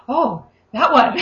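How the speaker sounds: slow, pleased mock gasp of recognition; light up and point someone out; delighted recognition. To Lizzie, acting out spotting the one, pleased surprise.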